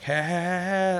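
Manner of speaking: high mock yell of hey; retold yelling girl. Escaped captive yelling at the player, mock yell. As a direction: call out after him, hammed-up. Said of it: "she was running off like".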